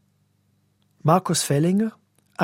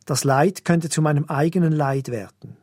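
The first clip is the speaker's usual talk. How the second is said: ordinary delivery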